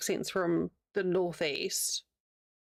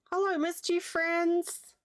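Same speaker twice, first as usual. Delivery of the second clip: ordinary talk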